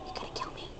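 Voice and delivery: breathy whisper; hushed plea. Real, not acted. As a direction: whisper urgently. Whispered in game.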